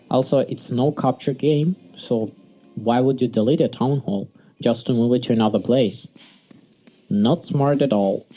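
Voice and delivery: ordinary talk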